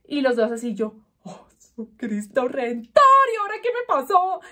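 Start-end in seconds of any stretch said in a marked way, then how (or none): 1.2-4.4 high-pitched, hammed-up panicked cry; dramatic alarm. Woman retelling her shock at her swollen red fingers, dramatic alarm. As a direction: replay your shock with a rising, melodramatic wail.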